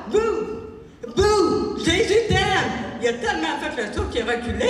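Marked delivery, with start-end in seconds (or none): 0.1-2.7 woman scaring the policeman with her cry; naked preacher booing. Retold or imitated. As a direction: jump out at him and chant. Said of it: "Elle se vire de bord".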